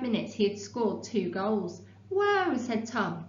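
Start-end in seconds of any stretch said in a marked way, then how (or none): 2.1-2.6 amazed. Acted. Boy amazed at goals.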